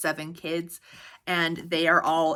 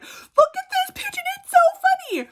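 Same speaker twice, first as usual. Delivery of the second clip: imitating mom laughing through the words, much higher and louder; laughing hysterically; 5 dB louder than usual. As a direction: squeal it like mom cracking up. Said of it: "she's just laughing hysterically".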